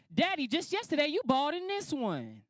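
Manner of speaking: high-pitched imitation of a little girl; young daughters. Daughter showing dad another painting, high teasing child voice. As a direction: play a child teasing her father, slowing at the end. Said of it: "my two young daughters was gonna get a kick out of this one".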